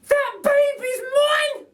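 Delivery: very high, loud, slow shout; overjoyed at finding him; Betty claiming her baby. A narrator's reading. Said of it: "says Burglar Betty".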